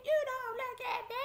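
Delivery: high, insistent warning; imitated guard's holler. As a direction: mimic a sheriff yelling in your ear. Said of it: "She's in my ear".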